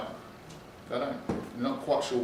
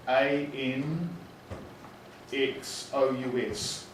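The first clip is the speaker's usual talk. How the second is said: slow school dictation; 4 dB quieter, much slower than usual. Shown as a demonstration. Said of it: "teacher demos spelling aloud".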